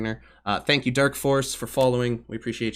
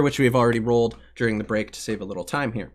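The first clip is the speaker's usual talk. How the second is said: usual speaking voice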